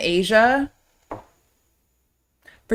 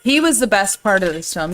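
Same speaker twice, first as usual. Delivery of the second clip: normal talking voice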